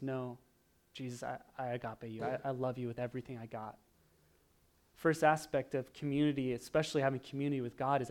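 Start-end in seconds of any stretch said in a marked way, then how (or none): 0.0-3.8 mild tearful confession. Retold or imitated. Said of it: "Peter breaks down crying".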